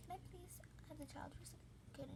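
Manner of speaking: soft, hushed plea at the door; quietly asking to take the boy; whispered request. Retold or imitated. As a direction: whisper it so the mother won't react. Asking neighbour for the child.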